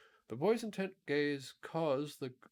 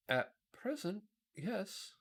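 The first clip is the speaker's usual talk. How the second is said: higher, sighing pumpkin voice; a weary little sigh, pitched up; sighing Pumpkinhead; 9 semitones higher, slower than usual. A narrator's reading. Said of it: "replied the Pumpkinhead with a sigh".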